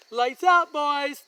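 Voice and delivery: put-on dad calling lights out to the boys; loud fatherly call. Dad calls bedtime.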